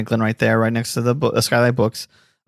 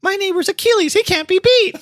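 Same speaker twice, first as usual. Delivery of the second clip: much higher silly pitch; squeaky cartoon voice. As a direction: squeak out the slogan. Mocking commercial slogan.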